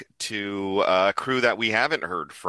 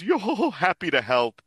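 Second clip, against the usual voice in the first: high falsetto character voice; Brook; 10 semitones higher than usual. Acted. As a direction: hoot it out high, like a cartoon performer. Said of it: "he says".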